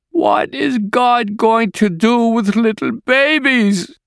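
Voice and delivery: high, slow despairing question; anguished cry. A narrator's reading. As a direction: cry it out slowly in grief. Grieving mother cries out to neighbour.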